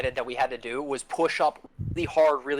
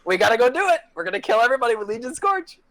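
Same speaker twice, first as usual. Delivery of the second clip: hyped up; 7 semitones higher, 5 dB louder, faster than usual. Retold or imitated. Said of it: "And then we're like".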